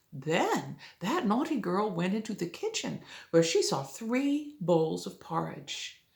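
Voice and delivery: plain reading voice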